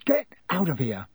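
mildly angry outburst. A narrator's reading. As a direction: shoo it away crossly. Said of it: "shoved Crookshanks angrily away".

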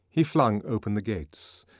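plain reading voice

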